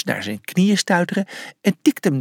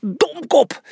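angry shout; 11 semitones higher, slower than usual. A narrator's reading. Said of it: "riep hij boos".

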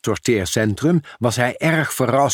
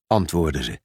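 usual speaking voice

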